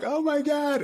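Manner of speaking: high, stunned realization; retold awe. Guest retelling his reaction to the sermon, stunned awe. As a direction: pitch it up as it hits you. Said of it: "And I was like".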